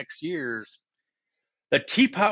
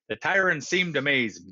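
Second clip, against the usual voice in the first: usual speaking voice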